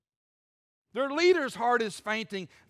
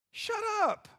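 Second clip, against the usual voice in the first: much higher, pinched "Shut up"; squeaky shut up; 12 semitones higher than usual. Shown as a demonstration. Preacher toying with God telling Joshua off, high mock voice. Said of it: "Can y'all hear it too?".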